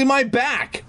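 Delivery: high helpless plea; helpless cry. Acted. Dog begging for a scratch, high helpless cry. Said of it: "I cried helplessly".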